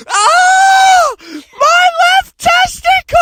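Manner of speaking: hammed-up agonized shriek, high and slow; mock pained scream. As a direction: scream like you just got shot there. Friend shot, screaming.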